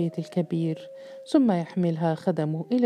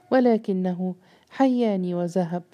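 ordinary delivery, nothing marked

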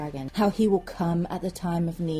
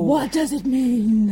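high, slow, loud bafflement; mock wonderment; 4 semitones higher, 5 dB louder, slower than usual. Co-host puzzling over the revelation, hammed-up wonder. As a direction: draw it out in exaggerated wonder. Playing a baffled, amazed reader.

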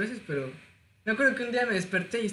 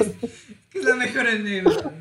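laughing; 3 semitones higher, 6 dB louder, slower than usual. Real, not acted. Laughing at own story.

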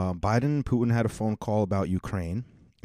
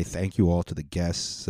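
normal talking voice

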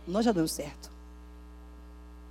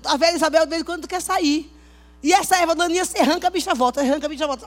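loud, high fervent preaching; heated pulpit exhortation; preaching at full force; 7 semitones higher, 10 dB louder than usual. Acted. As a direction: push it out with conviction.

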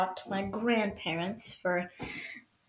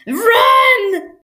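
high, loud drawn-out yell; play panic shouting; 11 semitones higher, 10 dB louder than usual. Pretend. Podcaster sees gunman coming, drawn-out panicked shout. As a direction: holler a mock alarm.

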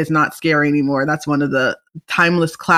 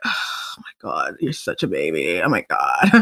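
mocking older cousin; 6 dB quieter than usual. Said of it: "And I'm like".

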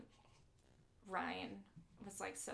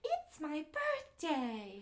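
high, drawn-out singsong doll voice; slow, loud, high-pitched pull-string toy; talking doll; 8 semitones higher, 5 dB louder, much slower than usual. Acted. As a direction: squeal it slowly like a talking doll. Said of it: "That's exactly how she talks".

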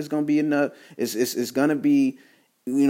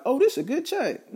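mock satisfied; 12 semitones higher, 3 dB quieter than usual. Mocking people pleased with pay.

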